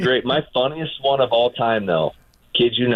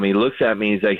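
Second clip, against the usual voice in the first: usual speaking voice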